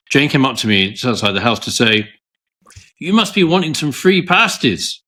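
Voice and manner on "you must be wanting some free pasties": a weak attempt at a Cornish accent, pitched up; lightly imitating a Cornish baker; bad Cornish accent. Telling the car show about the baker, in a poor Cornish accent. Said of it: "Not a very good Cornish accent".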